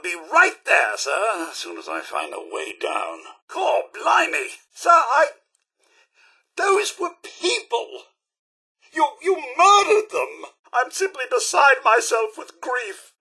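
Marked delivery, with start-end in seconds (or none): 3.5-13.1 high, shocked outcry with halting starts; aghast and grief-stricken, voice pitched up; horrified dismay. Acted. Butler accusing his sir of murdering people, horrified dismay.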